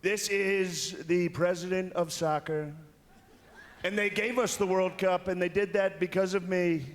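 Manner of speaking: high, slow mock Trump voice; Trump impression. Comic imitating Trump introducing soccer's president to Ivanka, slow. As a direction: drawl it like Trump to his daughter. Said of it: "and he goes".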